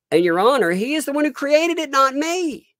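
high, loud protest to the judge; hammed-up indignant appeal to Your Honor; courtroom plea. Pleading to the judge about who created it, hammed-up protest.

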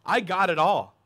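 put-on proud, loud young man; rich young man. Preacher voicing the ruler, proud and loud.

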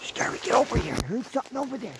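urgent whisper to the dog. Real, not acted. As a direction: hush it, calling the dog close. Calling dog, heard something.

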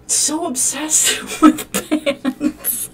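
slow, high, breaking into laughter; laughing exasperation. Real, not acted. Gamer laughing at a character's pan obsession, drawn out. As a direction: stretch it out, laughing in disbelief.

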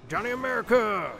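high, loud battle-cry scream; full-voiced, high-pitched yell. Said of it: "Did I just scream Johnny America?".

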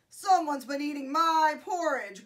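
loud, gruff storybook bear; Papa Bear growl. A narrator's reading. Papa Bear finds his porridge eaten, growled. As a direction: growl it out loudly as the big bear. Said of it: "growled the Papa Bear".

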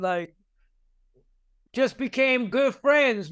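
ordinary delivery

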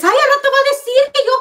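ridiculing how her sister talks, high whine; mocking sister voice.

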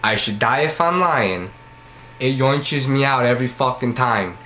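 ordinary talk, nothing marked

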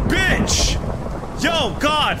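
loud, high-pitched furious shout; angry yell. Real, not acted. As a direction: yell it in pure rage. Raging at griefing player.

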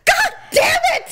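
high, loud mock cursing; exasperated shout. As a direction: imitate a driver at the end of patience. Imitates exasperated driver.

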